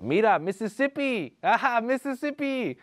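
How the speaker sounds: teasing excited call. Retold or imitated. Inmates pointing and teasing.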